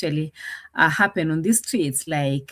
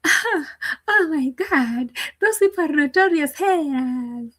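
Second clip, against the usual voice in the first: mock whiny quote; 9 semitones higher than usual. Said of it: "They're like".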